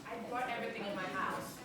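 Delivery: ordinary delivery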